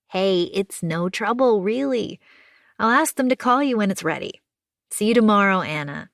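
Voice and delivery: kind neighbour, upbeat comfort; reassuring June. Acted. June says goodbye.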